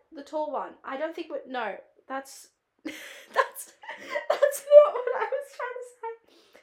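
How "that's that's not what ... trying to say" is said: talking through loud, high laughter; real laughing. Real, not acted. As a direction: crack up at your own slip, dragging the words out. Cracks up at her own wording.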